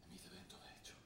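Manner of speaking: whispered almost to himself; a father whispering that he is growing old; resigned whisper. Acted. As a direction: let the words fade into a sad breath.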